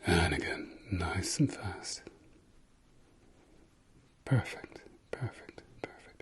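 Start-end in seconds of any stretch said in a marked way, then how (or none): 4.2-6.2 fading whispered praise. Acted.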